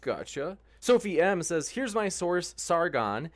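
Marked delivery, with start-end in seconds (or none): none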